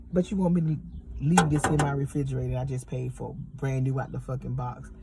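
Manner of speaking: ordinary delivery, nothing marked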